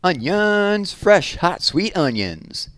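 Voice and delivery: loud street vendor's cry; calling out. A narrator's reading. Sam calling to the town from his cart, loud vendor cry. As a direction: hawk onions loudly down the street. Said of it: "Sam called".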